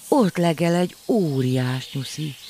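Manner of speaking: thrilled to see a big bunny; excited spotting. A narrator's reading. Child excitedly spotting animal. (izgatott felfedezés)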